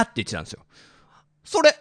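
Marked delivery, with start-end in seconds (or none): 1.4-1.7 name punched out, high; hit hard crying out. Real, not acted. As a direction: cry out your own name, indignant. Insists it was him, not Uraking.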